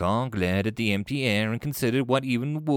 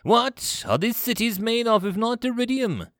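high-pitched, indignant question; exasperated demand; 12 semitones higher than usual. Acted. Commander bewildered by primitive cities, exasperated. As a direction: demand it in outraged disbelief.

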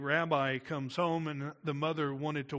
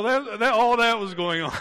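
laughing through the words, higher and louder; 5 semitones higher, 10 dB louder than usual. Real, not acted. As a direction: let the laugh spill over the words. Preacher laughs at the image.

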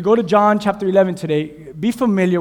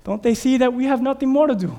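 higher, puzzled retort; believer reply; 7 semitones higher than usual. Retold or imitated. Preacher voicing believers answering the world, puzzled. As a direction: toss it back, pitched up. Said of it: "And they're like".

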